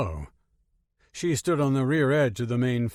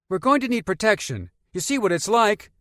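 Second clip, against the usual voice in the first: put-on boy voice; 10 semitones higher, 6 dB louder than usual. Boy argues for guns.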